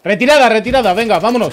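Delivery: loud urgent call to retreat; shouting orders over battle. Real, not acted. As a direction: yell the retreat to the squad. Ordering retreat under fire.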